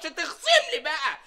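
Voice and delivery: indignant protest. Acted. Protesting a pay cut.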